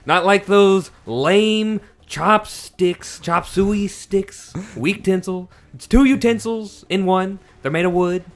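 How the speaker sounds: Trump impression. Praising forks for the stream as a mock Trump. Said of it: "That was the worst Trump impression I've ever heard".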